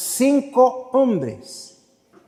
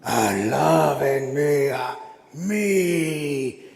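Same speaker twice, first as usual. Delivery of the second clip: low, very slow possessed voice; demonic voice; 4 semitones lower, 3 dB quieter, much slower than usual. Acted. Possessed boy demands worship, slow demonic voice. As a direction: drag it out like a demon. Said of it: "con una voz que ningún hombre puede replicar".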